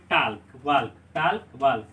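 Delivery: "टाल्क, वाल्क", audible L before each K; wrong pronunciation. Shown as a demonstration. Teacher showing how we read since childhood, टाल्क, वाल्क with L voiced. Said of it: "इसको हम बचपन से पढ़ते आए हैं".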